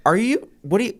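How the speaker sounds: baffled. Retold or imitated. Telling the car show guy he makes no sense, baffled. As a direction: sound totally lost at his claim. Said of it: "And I go".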